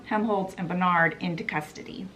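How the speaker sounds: ordinary delivery, nothing marked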